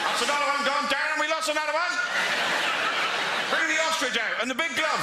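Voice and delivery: high, loud West Country shout; falconer calling out. Retold or imitated. West Country falconer shouting about lost birds, loud and high. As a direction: call it out across the field with a laugh. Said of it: "There was a fella there".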